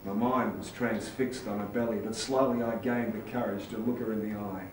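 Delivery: usual speaking voice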